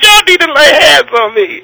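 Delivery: laughing, high, loud desperate plea; terrified plea. Retold or imitated. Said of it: "man, I was terrified".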